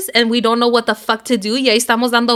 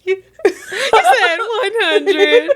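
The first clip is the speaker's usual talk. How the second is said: laughing; 9 semitones higher, 3 dB louder, much slower than usual. Real, not acted. Laughing accusation.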